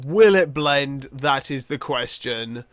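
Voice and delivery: loud on WILL, slow on the question; big catchphrase delivery. Retold or imitated. Gamer quoting a blender show's catchphrase, grand delivery.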